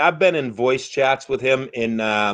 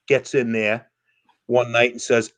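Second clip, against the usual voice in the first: ordinary delivery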